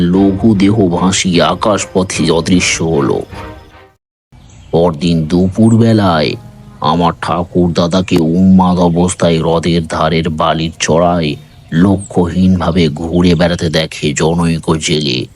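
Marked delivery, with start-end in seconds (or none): none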